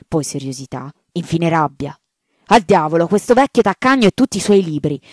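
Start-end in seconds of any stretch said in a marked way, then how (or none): 2.5-5.0 loud, furious outburst from the rector; louder, raging at the stingy old man; angry shout. Acted. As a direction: bellow it, slamming the papers down. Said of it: "gridò sbattendo sul tavolo le carte".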